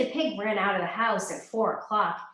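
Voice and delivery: ordinary delivery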